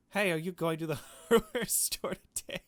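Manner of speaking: high, loud mock-innocent voice with a laugh; mock sly voice. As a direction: play it too innocent. Voicing a mock text with a laugh.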